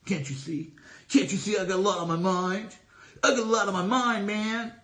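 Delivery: a half-acted snide grumble, voice shifted from his own; snide retort. To Sean in the car, a mock snide brush-off. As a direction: brush off the question like a man with a lot on his mind. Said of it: "you know, a snide way".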